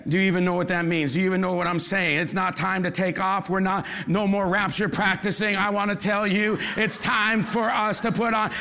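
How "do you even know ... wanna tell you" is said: normal talking voice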